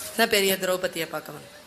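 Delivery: sneering echo of என்ன பெரிய திரௌபதியை with a falling scornful tone; mocking repeat. Dharma mocking Arjuna's excuse, with a scornful echo. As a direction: throw the younger brother's words back at him. Elder brother mocks Arjuna's words.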